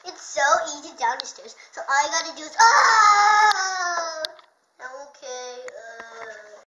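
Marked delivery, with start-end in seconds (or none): 2.6-4.2 loud startled yell; falling yell. Acted. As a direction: yell out as you tumble. Falling down the stairs.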